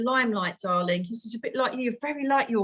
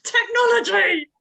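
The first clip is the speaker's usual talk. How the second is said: higher, louder and slow, fed up with the lag; exasperated cry; 10 semitones higher, 7 dB louder, slower than usual. Real, not acted. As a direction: throw the word out in mock despair at the tech. Exasperated at stream delay.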